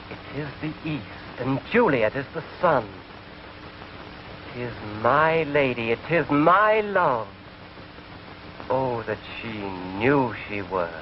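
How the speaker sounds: measured, long dramatic pauses; Shakespeare recitation. Acted. Actor reciting the balcony scene, earnest theatrical delivery. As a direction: recite it as a hushed, lovestruck Romeo. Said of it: "start reciting Shakespeare like that".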